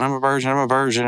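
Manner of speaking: light mock yell, quick; half-shouted, rushed retold yell.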